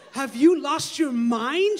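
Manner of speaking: loud, slow, appalled yell; screaming. Acted. Daughter yelling slowly and loudly at both her parents. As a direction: drag out each word in a loud yell. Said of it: "she screamed".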